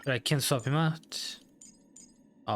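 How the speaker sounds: ordinary delivery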